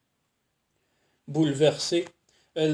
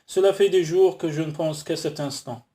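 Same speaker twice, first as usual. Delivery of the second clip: plain reading voice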